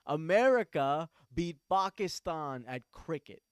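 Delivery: ordinary talk, nothing marked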